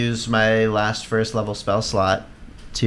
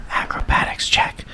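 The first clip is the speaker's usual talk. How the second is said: whispering; slower than usual. Real, not acted. Whispered suggestion to player.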